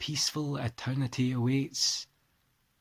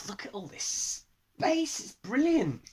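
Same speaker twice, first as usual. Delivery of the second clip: delighted; 12 semitones higher than usual. Real, not acted. Delighted by new landscape format.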